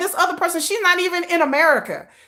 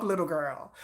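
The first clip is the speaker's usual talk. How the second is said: dropped low and soft, dismissive; condescending low jab; taunting little girl; 11 semitones lower, 12 dB quieter than usual. Acted. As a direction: sneer it down at her. Taunting a hater.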